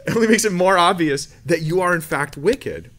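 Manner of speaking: loud, high, mock-accusing voice of Romans; mock scolding. Preacher voices Romans chapter two as a loud mock accusation. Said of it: "that was a dumb joke".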